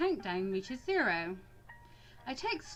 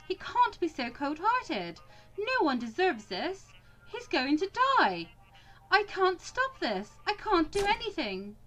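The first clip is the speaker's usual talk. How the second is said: higher-pitched, urgent inner pleading; desperate pleading; 6 semitones higher than usual. Acted. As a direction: plead helplessly to stop what's coming. Desperate inner plea before shooting.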